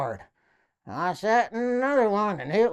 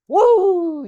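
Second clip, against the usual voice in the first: excited cheer; 11 semitones higher, 9 dB louder than usual. Real, not acted. Outro cheer after the joke.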